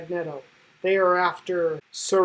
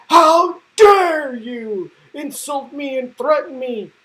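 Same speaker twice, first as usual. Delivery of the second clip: slow, louder, higher offended huff; indignant outrage; 5 semitones higher, 8 dB louder than usual. Retold or imitated. Government official protests to Cyclops, slow and outraged. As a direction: drag it out, deeply insulted. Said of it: "this guy's like".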